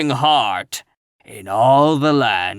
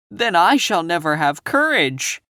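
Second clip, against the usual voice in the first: lightly voiced lion, a touch louder; lion declaring; 5 semitones higher, 4 dB louder than usual. A narrator's reading. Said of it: "declared the lion".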